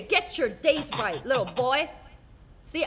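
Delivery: ordinary talk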